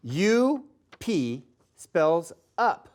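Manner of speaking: letters spelled out one by one, very slowly; slowly spelling "U P" letter by letter. Shown as a demonstration. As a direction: spell it for kids, U... P..., then up. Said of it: "Let's spell up".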